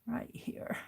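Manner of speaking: whispering. Real, not acted. Crafter murmurs to herself while sewing.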